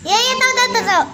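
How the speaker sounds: loud, annoyed I know already; impatient. Real, not acted. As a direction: brush her off, irritated. Child impatient with repeated explanation.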